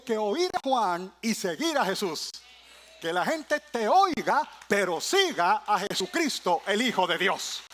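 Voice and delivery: loud, driving sermon cadence; fiery preaching. Acted. Preacher to church, full-force preaching. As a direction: preach it out to the congregation. Preaching climax over music.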